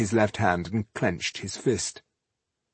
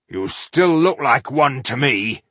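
defiant gruff; 4 semitones higher, 6 dB louder than usual. Acted. Ironheart defiant.

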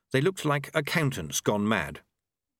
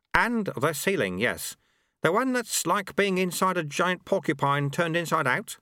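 higher-pitched sweeper voice; sweeper character, matter-of-fact; 8 semitones higher than usual. Acted. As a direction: confirm the grisly details in character.

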